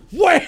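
loud high "wham" impact noise; slap sound effect. Pretend. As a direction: shout the hit like a cartoon smack. Imagining slapping a bird midair.

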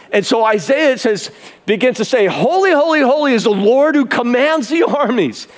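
high, ringing sermon climax; full-force preaching. Real, not acted. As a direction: proclaim it to the whole barn.

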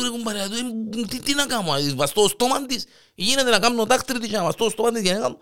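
high, rushed complaint; helpless high protest. Acted. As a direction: protest that nothing can be done. (αβοήθητη, ψηλόφωνη διαμαρτυρία)

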